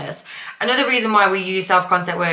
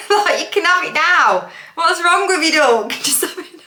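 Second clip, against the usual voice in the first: higher, laughing through the words; 10 semitones higher, 3 dB louder than usual. Real, not acted. Host teasing viewers while laughing, high and giggly. Laughing at her own joke.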